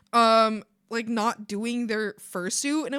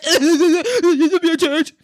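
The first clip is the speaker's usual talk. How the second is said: loud, slow, higher silly whine; mocking whiner; 5 semitones higher, 10 dB louder, slower than usual. Mimicking the guest about the church, mocking whine. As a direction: parrot it mockingly, stretched out and loud.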